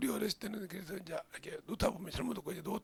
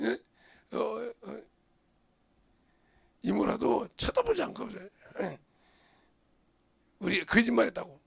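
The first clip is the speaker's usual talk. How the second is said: loud, driving sermon peak; fiery preaching; 9 dB louder than usual. Real, not acted. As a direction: preach it hard to the congregation. (힘차게 외치는 설교)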